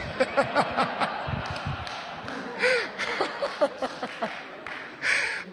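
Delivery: silent wheezing laugh; breathy laugh. Real, not acted. Preacher laughing at her own doctor story, silent wheeze. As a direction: laugh through air without voice.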